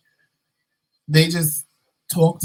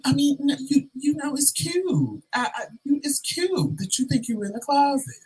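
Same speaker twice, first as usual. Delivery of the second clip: high, cooing campy tease; 10 semitones higher than usual. Acted. Coworker humouring a closeted young man, high teasing coo. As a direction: coo it high, stumbling over the words.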